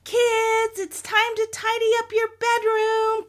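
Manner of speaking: put-on high, loud sing-out to the kids; mama's high-pitched call. A narrator's reading. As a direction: call it across the house as a mother. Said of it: "called Mama".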